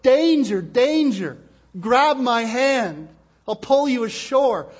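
loud, high shout of danger; urgent warning yell. Acted. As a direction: yell it like calling to swimmers from the shore. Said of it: "he could yell at the top of his lungs".

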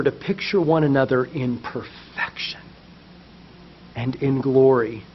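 hushed whisper. Real, not acted. Sudden hush on vision.

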